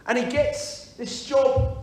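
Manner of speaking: ordinary delivery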